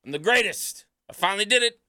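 high, loud gloating heel cackle; shrill gloating wrestling manager. Pretend. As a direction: crow like a smug heel manager. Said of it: "like an old heel manager".

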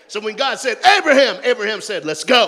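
loud, high preacher's cry; hyped preaching; full-force preaching. Acted. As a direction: preach it up with the crowd.

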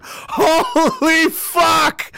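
slow, laughing shout of shock. Pretend. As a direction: cry out while laughing. Onlooker stunned, laughing.

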